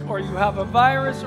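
normal talking voice

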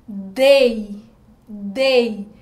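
dei said loud and drawn out, syllable isolated. Shown as a demonstration. Teacher to pupils, isolating the syllable dei, loud. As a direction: call out dei loudly so the strong vowel rings. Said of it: "Qual é o som mais forte?".

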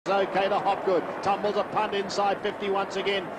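normal talking voice

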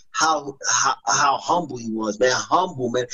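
fighting back tears remembering his father; choked up. Real, not acted. Son remembering his humble father, near tears. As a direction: voice dropping low and breaking on humble.

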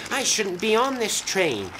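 high-pitched, worried protest; gasped dismay. A narrator's reading. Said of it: "gasped Toad".

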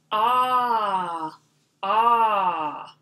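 ah held open and long; drawn-out ah. Shown as a demonstration. Teacher isolating the vowel: long ah. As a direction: stretch the ah sound. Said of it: "What makes an ah sound".

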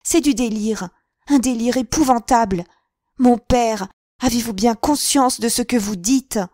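high and loud protest; indignant. A narrator's reading. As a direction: confront him in outrage. Daughter confronts her father.

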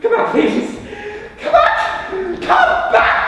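high, loud screamed plea; desperate calling. Acted. Screaming after the departing date.

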